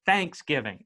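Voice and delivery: THANKSgiving, first syllable punched; Thanksgiving with the weight up front; stress on THANKS. Shown as a demonstration. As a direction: hit the start of Thanksgiving, Southern style. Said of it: "They put the emphasis on thanks".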